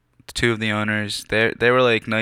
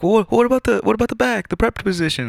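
much higher, pleading voice; high desperate plea; 13 semitones higher, faster than usual. Retold or imitated. Begging the owners for the prep job, in a high pleading voice. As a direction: beg in a high, cracking voice.

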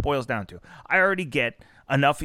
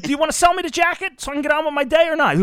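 fast, high, fed-up retort; exasperated demand; 12 semitones higher, 4 dB louder, faster than usual. Retold or imitated. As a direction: rush it out, pitched high and annoyed. Retold store argument, exasperated.

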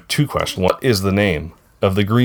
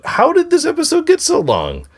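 high, incredulous exclaim; exasperated surprise. Real, not acted. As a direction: marvel at how long it got. Exclaiming at long episode.

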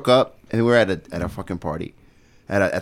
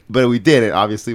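normal talking voice

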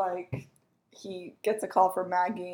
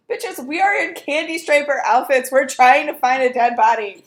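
louder, higher mock exasperation; exasperated [name]; 4 semitones higher, 8 dB louder than usual. [name] scolding the friends in candy striper outfits, loud exasperation. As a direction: snap at the group.

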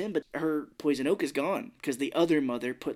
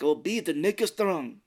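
put-on slow, higher Russian accent; 4 semitones higher than usual. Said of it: "he's got the Russian voice".

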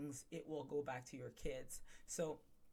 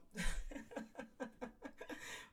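amused laugh; 6 semitones higher, 4 dB quieter than usual. Real, not acted. Laughs after her impression.

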